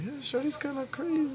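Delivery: high squeaky voice. Retold or imitated. Retold own thought, high voice.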